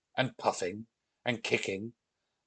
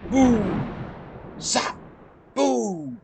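high, drawn-out thunder booms; explosive zap between swooping booms; storm sound effects; 12 semitones higher, 4 dB louder, much slower than usual. A narrator's reading. Narrator imitating thunder over the house, explosive.

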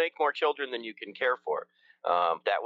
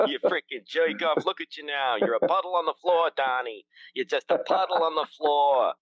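New York tough guy. Retold or imitated. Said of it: "I fucking love that New York accent".

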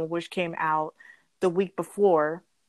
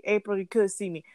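ordinary talk, nothing marked

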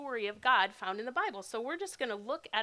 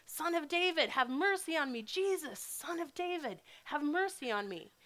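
raised, higher repeated cry; yelling louder; 5 semitones higher than usual. Acted. Blind beggar ignores the crowd, calling higher again. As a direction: keep calling out though they hush you. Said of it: "He just yells louder".

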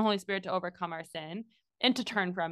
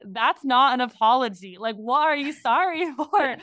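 higher and louder, laughing indignation; mock indignant; 5 semitones higher, 6 dB louder than usual. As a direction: call out a fake apology, half laughing. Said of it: "And you're like".

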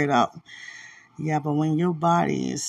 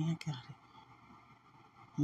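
slow, soft, worn-out whisper; whispered exhaustion; 4 semitones lower, 18 dB quieter, much slower than usual. Real, not acted. Tired, whispering to Dwight about her burden. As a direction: barely breathe it out. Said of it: "It's so heavy".

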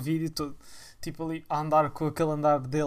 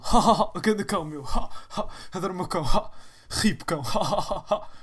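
manic mock laughter; 2 semitones higher, 5 dB louder than usual. Impersonates youtuber laughing at dead dog.

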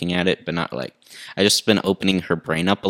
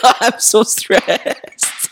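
self-mocking stressed cry; 10 semitones higher, much slower than usual. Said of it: "I'm like".

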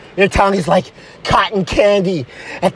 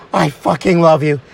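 low, heartfelt declaration of love; earnest low lover's voice; smitten boyfriend; 8 semitones lower than usual. Acted.

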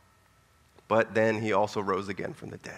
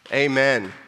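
loud amen; 5 dB louder than usual. Real, not acted. Preacher calls for response.

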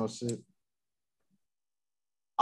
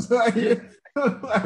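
laughing; 10 semitones higher, 5 dB louder than usual. Real, not acted. Laughing mid-sentence.

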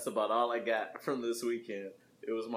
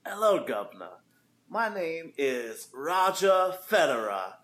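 attempted Australian accent; 7 semitones higher, 8 dB louder than usual. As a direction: do a loud, lilting, off-target Australian introduction. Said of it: "like, an Australian accent for that".

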